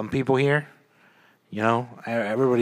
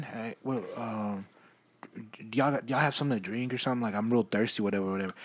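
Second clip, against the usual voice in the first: mumbling stranger; 3 semitones lower, 9 dB quieter than usual. Retold or imitated. Retelling stranger asking for drinks.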